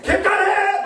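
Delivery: shrieking high yell of a defiant daughter; daughter's yell. Retold or imitated. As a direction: scream it back at mom. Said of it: "막 이러면서". (엄마에게 대드는 딸, 높은 비명)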